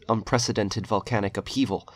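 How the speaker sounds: plain reading voice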